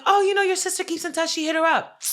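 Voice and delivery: high, quick mock-mom voice; imitated breezy mom. Daughter imitating mom explaining the sister keeps in touch, mock breezy. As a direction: rattle it off high, mimicking mom.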